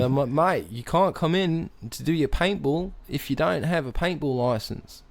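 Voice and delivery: put-on Aussie official; Australian accent. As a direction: play a mock Australian turning someone away. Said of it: "you've sustained this accent".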